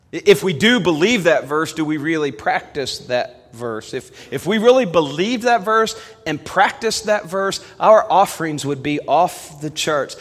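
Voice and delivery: loud, high-pitched pulpit delivery; emphatic preaching. Acted. Preacher urging the church to believe the verse, loud emphatic preaching. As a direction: drive the point home from the pulpit.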